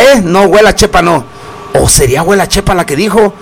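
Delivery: ordinary delivery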